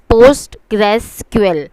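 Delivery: "Postgres S Q L" said as POST-gres, then the letters S, Q, L; pronunciation demo. Shown as a demonstration. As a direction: show clearly how "Postgres S Q L" is pronounced, part by part. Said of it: "This is pronounced as".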